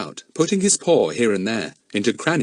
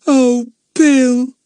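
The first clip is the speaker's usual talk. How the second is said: put-on high, louder girl's wail; a girl on the verge of tears; tearful girl pleading with Bill; almost in tears; 9 dB louder than usual. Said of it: "almost in tears".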